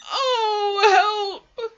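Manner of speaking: loud, high wail for help; distressed Pooh. A narrator's reading. Pooh with his head stuck in a jar, wailing loudly. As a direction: cry out in dismay. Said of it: "he said inside the jar".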